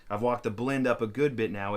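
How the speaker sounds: normal talking voice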